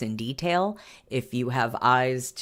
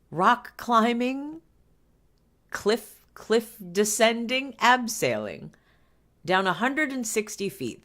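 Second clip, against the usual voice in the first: incredulous; 8 semitones higher than usual. Real, not acted. Incredulous at the danger.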